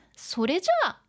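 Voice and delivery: put-on high, perky anime-girl quote; Nijika-chan. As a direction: lift it into a lively character voice. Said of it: "Nijika-chan says".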